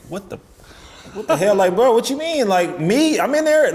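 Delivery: retold "who, me?" outrage; incredulous protest. Pitcher suddenly told he has to go in, incredulous. Disbelief at being picked to pitch.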